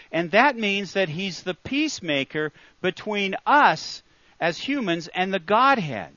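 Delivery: ordinary talk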